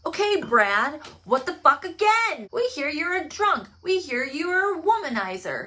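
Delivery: hammed-up exasperated grilling; accusing. Detectives grilling Brad again, loud accusing.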